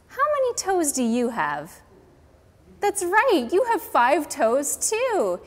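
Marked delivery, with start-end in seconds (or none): 2.8-5.4 bright, loud teacher praise; cheerful praise. Acted. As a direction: cheer the kids on. Teacher praising children's answer.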